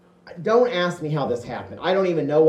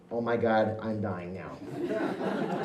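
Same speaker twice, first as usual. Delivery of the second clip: laughing; 6 semitones lower, 8 dB quieter, much slower than usual. Real, not acted. Laughing at the memory.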